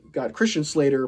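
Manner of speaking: ordinary talk, nothing marked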